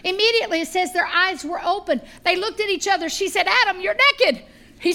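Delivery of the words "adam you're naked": loud, high mock shock; startled discovery. Eve noticing Adam is naked, acted loud surprise.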